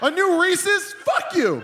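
high, laughing indignant yell; outraged at a candy bar; mock outrage. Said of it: "No, motherfucker".

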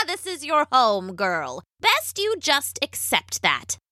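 witch voice coming out of a cackle, starting high and dropping; scornful witch. A narrator's reading. Witch scorns the captive's hope of freedom, witchy and mocking. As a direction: tell her off as a high, swooping old witch. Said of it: "The witch laughed".

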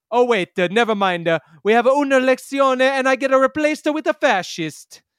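hammed-up Italian accent, high and loud. Reading a fake letter from Italy's prime minister, hammy Italian accent. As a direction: play a broad comic Italian prime minister. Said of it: "just with that accent".

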